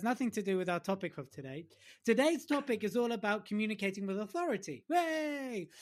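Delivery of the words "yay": high, drawn-out cheer. Pretend. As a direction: whoop it like a small celebration. Mock cheer for the topic.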